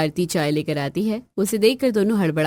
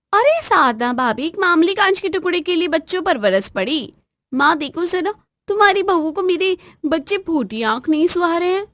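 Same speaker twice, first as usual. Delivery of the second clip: high, loud indignant scolding; angry sister-in-law; 10 semitones higher, 4 dB louder than usual. Acted. Sister-in-law defending her children, loud high angry scolding. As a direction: tear into her for scolding the kids. Neelam accuses bhabhi.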